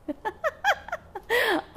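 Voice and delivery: bursting into high laughter. Real, not acted. Laughs at profound message.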